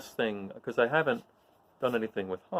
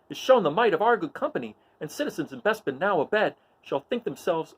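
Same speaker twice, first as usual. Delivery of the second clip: high, ringing battle oration; higher, louder heroic call to arms; Luke's rallying speech; 9 semitones higher, 5 dB louder than usual. Acted. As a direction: rouse the troops like a stage king. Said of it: "Luke offers some encouraging words".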